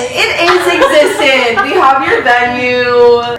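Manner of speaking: slow, loud and emphatic; insistent. Real, not acted. Sister insists on wedding.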